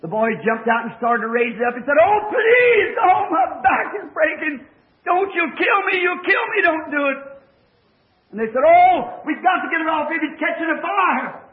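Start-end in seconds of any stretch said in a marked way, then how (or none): 2.0-7.2 high, loud pained plea; a boy pinned under a car cries out in pain; desperate pleading. Retold or imitated. 8.6-11.4 raised, hurried call to lift it; urgent alarm. Retold or imitated. Boys urgently lifting the car.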